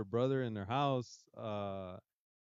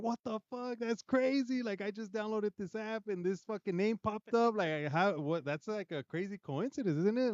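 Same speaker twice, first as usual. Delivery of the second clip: much higher, half-shrieking shocked retelling; freaked-out disbelief; 9 semitones higher than usual. Retold or imitated. Retelling the brother-in-law's reaction to the ghost app, high shocked voice. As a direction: play the spooked guy who can't believe the name popped up.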